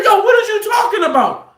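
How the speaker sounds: high-pitched combative comeback; retold indignant shout; matching his hollering. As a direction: holler back, baffled and heated. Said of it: "I'm matching his energy".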